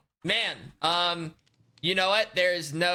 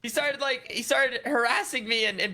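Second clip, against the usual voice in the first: whiny complaint; 8 semitones higher, 4 dB louder than usual. Acted. Complains to the manager.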